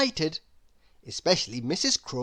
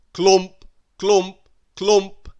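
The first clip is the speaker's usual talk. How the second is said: loud, heavy stomping words; heavy clumping steps; 8 dB louder, much slower than usual. A narrator's reading. Mister Noisy clumping up the hill, loud. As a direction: stomp each word out. Heavy footsteps sound word.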